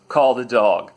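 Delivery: relayed order. Retold or imitated. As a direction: pass the order firmly down the chain.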